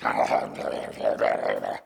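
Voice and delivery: raspy munching growl noise; cookie monster gobbling. Acted. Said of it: "we were like the cookie monsters".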